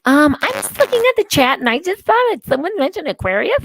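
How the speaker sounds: high, prim church lady voice. Retold or imitated. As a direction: play the prissy church lady. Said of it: "And she's like".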